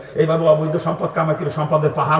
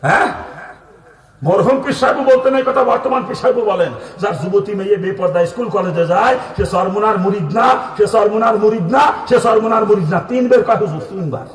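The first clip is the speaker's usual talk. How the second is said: high-pitched, insistent declamation; raised pulpit voice, driving the verdict in; emphatic preaching; 4 semitones higher, 4 dB louder than usual. Real, not acted. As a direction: hammer each repeated phrase home. Preacher hammers home the pir's verdict.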